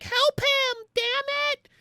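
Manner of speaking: very high, loud mock yell; desperate shout. Darcy blowing her cover to plead, desperate shout. Darcy runs out demanding help.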